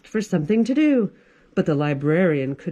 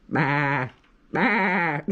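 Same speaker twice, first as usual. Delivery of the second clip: bleat; 4 semitones lower than usual. Acted. Goat bleats.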